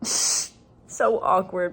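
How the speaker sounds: embarrassed giggle, stretched out; laughing. Real, not acted. Coach laughing at forgetting a student's name, slow and giggly. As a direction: laugh through the words, slowly.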